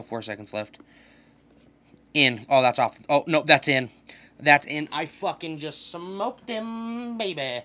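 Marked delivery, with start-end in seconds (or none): 4.9-7.6 gloating drawl. Real, not acted. Gloating after scoring.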